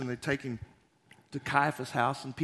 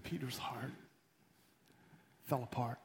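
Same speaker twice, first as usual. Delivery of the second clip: hushed narration; 4 semitones lower, 12 dB quieter than usual. A narrator's reading. Narrating heartbreak.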